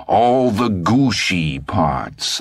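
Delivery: slow, relished mockery of gooshy; mocking the boy. A narrator's reading. Old man mocks Todd's words to reporters. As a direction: savour "gooshy" with a sneer.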